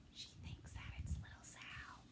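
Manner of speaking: soft unvoiced whisper; whispered aside. Real, not acted. Reader whispering an aside to the children. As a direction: confide it in a whisper.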